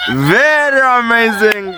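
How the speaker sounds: high, gliding cry with laughter in it; amused exclamation. Real, not acted.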